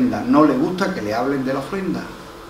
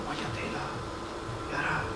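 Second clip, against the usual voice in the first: muttered aside; 16 dB quieter than usual. Retold or imitated. Muttering to himself about car.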